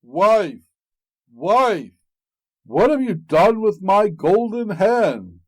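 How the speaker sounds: loud booming ogre call; big ogre shouting for his wife. A narrator's reading. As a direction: bellow for the wife across the house. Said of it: "he heard him calling".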